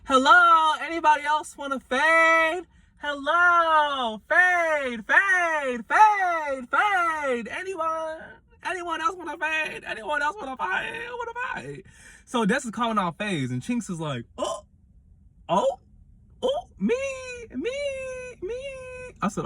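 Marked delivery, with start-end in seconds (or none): 0.0-11.8 high, hollered challenge fading into the distance; very high drawn-out calls, softening toward the end; mock calling out. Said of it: "Des calls out Faith". 14.4-18.6 high, squeaky little mock pleas to be picked; imitating someone begging to go next; eager volunteer. Imitates an eager volunteer answering the challenge.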